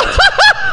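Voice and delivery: high-pitched burst of laughter. Real, not acted. Comic laughs telling podcast hosts the story, high burst of laughter. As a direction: crack up loudly.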